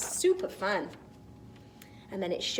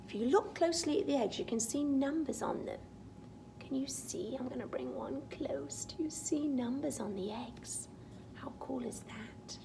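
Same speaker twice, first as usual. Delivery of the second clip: whisper; 5 semitones higher, 7 dB quieter than usual. Real, not acted. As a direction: whisper like a secret. Whispering to child about eggs.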